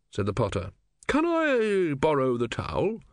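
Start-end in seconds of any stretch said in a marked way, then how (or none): 1.1-3.0 put-on old man voice. A narrator's reading. Legibus asking the potter.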